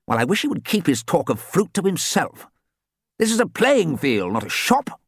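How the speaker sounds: put-on lightly stern old gentleman; a touch of grumpy coach. Archie speaks sternly.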